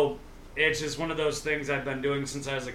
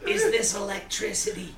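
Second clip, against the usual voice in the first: Mort voice; 6 semitones higher, 7 dB louder than usual. Pretend. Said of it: "You sounded like that dude from fucking Family Guy".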